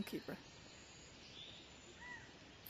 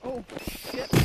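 normal talking voice

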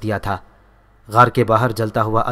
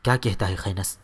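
worried; 3 semitones lower, 6 dB quieter than usual. A narrator's reading. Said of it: "فکر گیر لہجے میں پوچھا".

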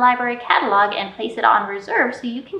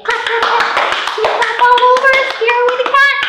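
very high, louder squeaky cry; squeaky high mouse; 13 semitones higher, 6 dB louder than usual. Acted. Librarian voicing the mouse scaring the cat, squeaky high.

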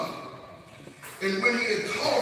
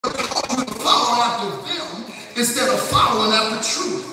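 forceful preaching; 4 semitones higher, 4 dB louder than usual. Real, not acted. Preacher warning the church of false preachers, forceful preaching. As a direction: drive the warning home from the pulpit.

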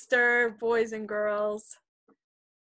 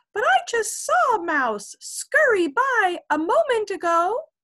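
very high, loud buzzy little fly; tiny fly voice; 12 semitones higher, 7 dB louder than usual. Acted. Fly answers the kitten, very high and tiny.

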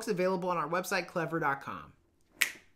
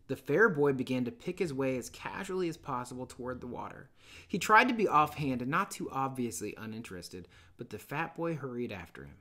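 steady, measured read-aloud narration; calm literary storytelling voice; story narration; 5 semitones lower than usual. Acted. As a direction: read the novel to the class like an audiobook.

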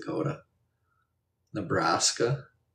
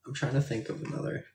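ordinary talk

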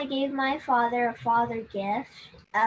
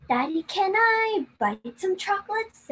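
put-on high pleading child voice; begging little sister; 9 semitones higher, 4 dB louder than usual. Little girl begging her dad for chocolate, high and pleading. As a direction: beg sweetly like a small girl. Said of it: "so desperate to get one that she said".

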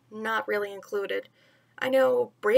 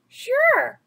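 much higher and louder, keen; eager boy; 11 semitones higher, 7 dB louder than usual. Acted. Mowgli answering Bagheera, high excited boy. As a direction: pop it up bright as a boy. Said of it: "Mowgli said".

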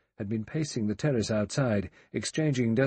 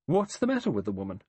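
sharp; faster than usual. A narrator's reading. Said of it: "Emily Brent said sharply".

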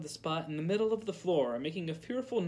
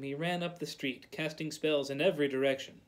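plain reading voice

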